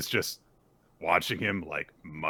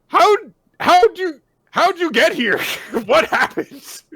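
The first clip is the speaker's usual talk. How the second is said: much higher, loud baffled squeak; befuddled villain; 13 dB louder than usual. Retold or imitated. Code baffled that Boruto appeared, high loud disbelief. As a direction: squeak out total confusion. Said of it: "looks at Boruto completely befuddled".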